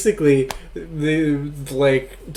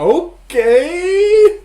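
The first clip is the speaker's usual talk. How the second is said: long drawn-out high okay; incredulous okay; 7 dB louder than usual. Real, not acted. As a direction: stretch okay out in disbelief. Deadpan reaction to silly plot.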